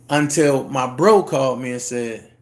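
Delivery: ordinary talk, nothing marked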